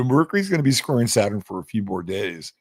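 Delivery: ordinary delivery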